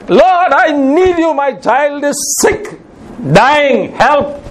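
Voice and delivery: put-on high, slow begging for a dying child; a mother's slow, high pleading; anguished plea.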